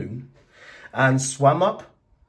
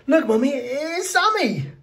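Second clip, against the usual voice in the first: put-on much higher excited little girl; excited child; 14 semitones higher than usual. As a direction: call out like a thrilled child. Sophia the child voiced.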